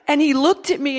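ordinary delivery

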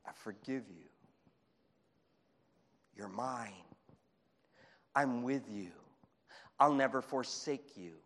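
soft, low, unhurried answer; tender reassurance. Acted. God answering a despairing worshiper, tender and quiet. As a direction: reassure them gently, hushed at first.

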